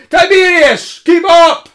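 loud, high call to a straggler. Acted. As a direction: shout back to hurry him. Calling to a lagging companion.

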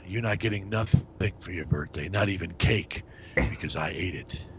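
gruff Seagal impression. Steven Seagal cameo impression.

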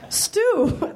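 high, shaky first try at a Scottish nanny; old woman voice. Acted. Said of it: "these voices are gonna get right".

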